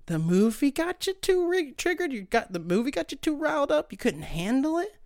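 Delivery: high, sing-song taunting; mock baby talk. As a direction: tease them like a crybaby. Mocking oversensitive moviegoers.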